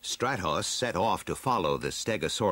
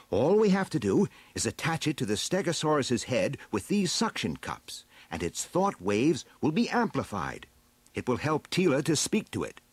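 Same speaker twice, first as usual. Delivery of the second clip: higher-pitched, eager explainer; inventor explaining; 7 semitones higher than usual. Acted. Man-at-Arms shows his device to friends, high eager explaining. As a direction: set out the plan in a high, emphatic voice.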